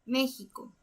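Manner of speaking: México softly, with the stress on Mé. Shown as a demonstration. Teacher repeats México after marking its accent, stressed E. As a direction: say México quietly, leaning on the first syllable. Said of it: "acentuar la vocal E".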